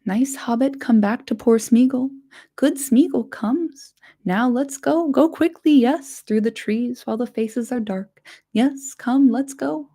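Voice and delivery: wheedling, hurrying whisper rising into eagerness; eager Gollum. Acted. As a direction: Gollum urging his master to leave at once. Gollum's voice held.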